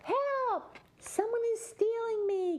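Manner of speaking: very high, squeaky cry for help; high-pitched panicked harp voice. A narrator's reading. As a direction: squeal it like a little magic harp being carried off. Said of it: "cried the harp".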